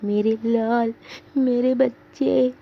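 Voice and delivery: put-on mild tearful old woman; a touch of weeping over the grandson. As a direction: grandmother laments in a lightly tearful voice. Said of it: "अम्मा रोनी आवाज़ में".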